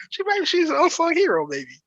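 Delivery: ordinary delivery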